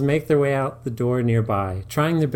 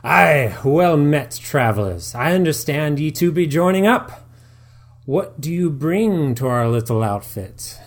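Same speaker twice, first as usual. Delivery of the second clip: put-on loud, higher hearty old-timey accent; pirate-ish brogue; 4 semitones higher, 6 dB louder than usual. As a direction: greet them like a salty adventurer. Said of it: "the man in the glasses".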